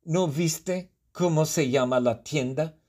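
a high, exasperated "ugh", then a scornful retort; rude clerk. Acted. The clerk rudely answers Jabari's price question, high and scornful. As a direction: brush off a dumb customer with disdain. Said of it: "un poco grosera, me responde".

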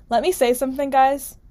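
ordinary delivery

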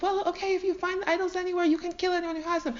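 high, hurried comeback; heated retort. Acted. Jacob answering his blustering father-in-law, heated retort.

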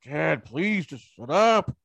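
stretched-out, pleading mock kid complaint; hammed-up kid's protest, rising high and loud; exasperated kid. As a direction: play an embarrassed child begging Dad to stop. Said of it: "the kids going".

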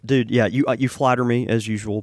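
ordinary talk, nothing marked

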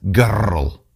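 put-on "girl" with a Scottish trilled R. Shown as a demonstration. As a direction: roll the R in "girl" like a Scot, drawn out. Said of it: "with a trilling R".

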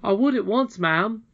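put-on eager boy Curdie. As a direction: call out like an earnest young miner. Curdie answers the lady eagerly.

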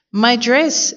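high-pitched storybook dismay; exclaiming. A narrator's reading. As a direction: cry it out in mild alarm. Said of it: "exclaimed Missus Rogers".

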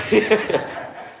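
a high, embarrassed giggle. Real, not acted. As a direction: giggle after the slip. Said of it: "Sorry, I didn't mean to say that out loud".

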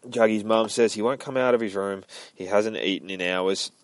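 ordinary delivery

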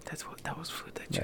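hushed whisper. Real, not acted. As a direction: say it under your breath. Whispered aside.

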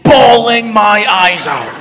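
loud, stretched-out emphasis; emphatic outburst. Real, not acted. Storyteller confessing to crying in barber's, emphatic outburst. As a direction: belt it out slowly for comic effect.

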